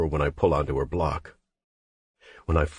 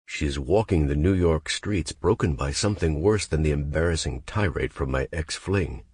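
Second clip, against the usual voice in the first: plain reading voice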